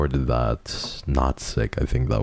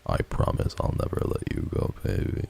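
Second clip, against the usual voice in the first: low, softer, voiceless delivery; sick-sounding debut line; 9 semitones lower, 6 dB quieter than usual. Shown as a demonstration. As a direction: murmur the catchphrase low and intimately. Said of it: "I was just so sick sounding".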